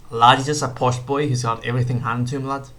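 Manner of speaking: badly faked Scouse accent; terrible mock Scouse accent; Paddy Pimblett accent. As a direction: have a poor go at mimicking a Scouse fighter. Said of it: "That's a terrible Paperman accent".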